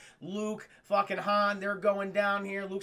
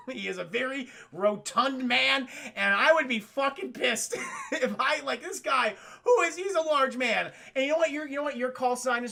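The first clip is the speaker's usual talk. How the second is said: normal talking voice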